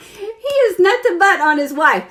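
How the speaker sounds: cracking up mid-sentence; can barely get it out for laughing. Real, not acted. Laughing while telling.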